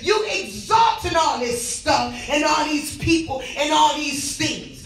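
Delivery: hollering revival preacher. Real, not acted. Hollering rebuke.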